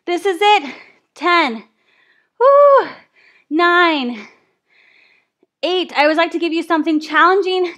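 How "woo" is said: high, loud cheering woo; excited whoop. Real, not acted. Coach cheering class through last reps, loud excited whoop. Cheering mid-set during workout.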